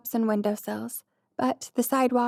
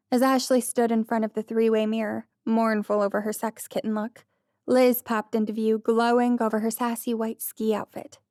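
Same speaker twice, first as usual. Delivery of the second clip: ordinary delivery, nothing marked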